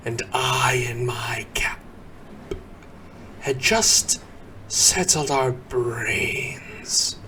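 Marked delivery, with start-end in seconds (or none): 0.1-1.8 soft whisper; hushed whisper. A narrator's reading. Narrator whispering about settling down for sleep, hushed. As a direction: keep it under your breath. Bedtime hush in Christmas poem.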